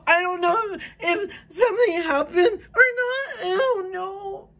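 sobbing out panic, wanting to leave; panicked crying. Real, not acted. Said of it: "I'm panicking".

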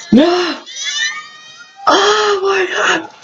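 high, stretched-out terrified shout; screaming in fear. Real, not acted. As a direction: scream it as the coaster drops. VR coaster drop, screaming.

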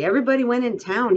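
a reader's simple Lenny, eager. A narrator's reading.

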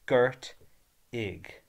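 "Gurt ig" broken into two separate syllables, the second dropping away; split into syllables. Shown as a demonstration. Teacher splitting the verb into syllables for learners. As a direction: say "Gurt" then "ig" as two beats. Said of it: "Two syllables".

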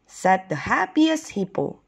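ordinary delivery, nothing marked

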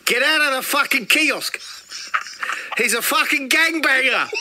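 high, shouted South African order; South African copper. Acted. Copper orders people out of kiosk, South African shout. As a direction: bark it out in broad South African. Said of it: "there's a South African copper there".

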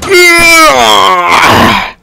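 long, high cheer; triumphant shout. Real, not acted. Celebrates success in game.

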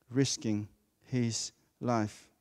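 quiet drawn-out emphasis; slow emphasis. Shown as a demonstration. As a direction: linger on his life, quietly. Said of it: "Highlight the word".

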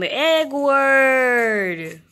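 loud, high call across a field; loud searching call. Real, not acted. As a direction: call the lost pet from afar. Calling out for pet.

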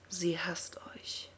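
breathy taunting whisper, slow. A narrator's reading. Kel whispers to Arthas that she hates him. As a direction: whisper it like poison into his ear. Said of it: "flüsterte Kel".

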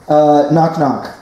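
ordinary delivery

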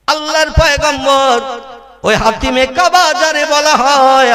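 high, drawn-out sermon chant; melodic preaching. Acted. Preacher telling the crowd of the Hatim, melodic preaching. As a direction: sing the words out like a preacher.

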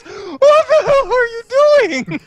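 high, loud startled shout with a laugh; startled yelp. Acted. August to Mila, startled and indignant. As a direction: yelp it out in shock.

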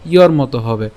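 normal talking voice

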